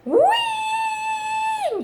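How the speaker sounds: high, loud gleeful whoop; a mouse flung skyward, squealing with joy; shouted whee. A narrator's reading. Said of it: "shouted the mouse".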